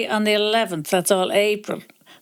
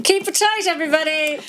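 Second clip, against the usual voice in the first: upbeat sign-off; 7 semitones higher than usual. Real, not acted. Sign-off catchphrase.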